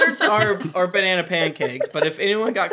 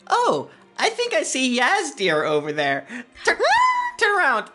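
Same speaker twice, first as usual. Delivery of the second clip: Toby voice; 9 semitones higher than usual. Acted.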